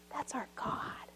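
slow, breathy whisper of awe; awed whisper. Real, not acted. Pastor whispering about God's grace to the congregation, awed and hushed. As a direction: hush it reverently. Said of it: "whispered awe".

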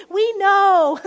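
loud, punched "We know" with a falling end; emphatic declaration. Real, not acted. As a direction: hammer home "We know" as a bold claim. Emphatic repetition to congregation.

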